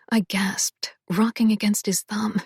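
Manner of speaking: ordinary delivery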